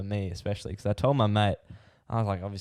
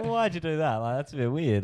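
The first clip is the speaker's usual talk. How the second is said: laughing; 6 semitones higher than usual. Retold or imitated. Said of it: "I'm basically like".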